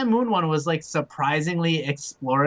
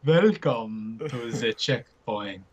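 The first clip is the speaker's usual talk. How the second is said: low, slow vampire voice; spooky voice; 5 semitones lower, much slower than usual. Acted. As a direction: drag it out low like a creepy vampire.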